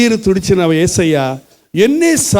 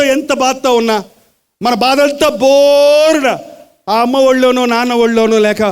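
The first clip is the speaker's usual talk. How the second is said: fervent preaching; 6 semitones higher than usual. Acted. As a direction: preach it with rising passion. Preaching with rising force.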